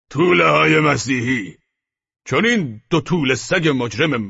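big, theatrical, contemptuous captain's voice; haughty, scornful captain. A narrator's reading. Levantine captain names his ransom to Candide, scornful and loud. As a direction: spit out the insult, then demand payment.

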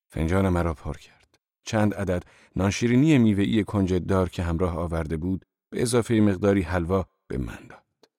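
ordinary delivery

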